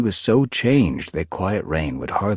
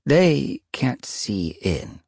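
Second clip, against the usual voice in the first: ancient she-cat; 7 semitones higher, much slower than usual. Acted. Stoneteller speaks.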